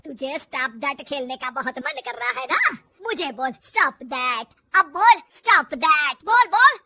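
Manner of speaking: menacing mother-in-law's challenge; angry dare. Acted. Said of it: "बहू पर गुस्सा आ जाता है".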